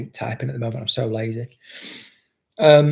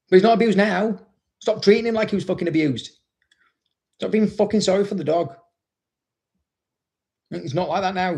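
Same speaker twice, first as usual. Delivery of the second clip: higher-pitched, emphatic protest; exasperated insistence; 7 semitones higher than usual. Real, not acted. Trainer telling owners to stop pitying rescues, exasperated. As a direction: push back hard, voice raised in pitch.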